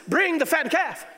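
high, loud shout of joy; a high, ringing, joyful order; exultant call. Acted. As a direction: cry it out as a father whose son is home. Father's command said again.

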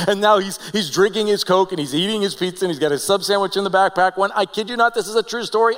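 usual speaking voice

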